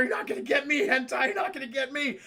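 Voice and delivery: high-pitched defiant taunt; defiant yelling. Retold or imitated. Han shooting at the creature, defiant yell.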